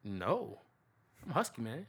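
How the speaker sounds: ordinary talk, nothing marked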